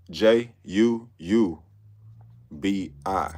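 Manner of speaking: J U U B I spelt letter by letter, slowly; slow spelling. Shown as a demonstration. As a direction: spell out J U U B I, each letter separate. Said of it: "I'm gonna spell it for y'all".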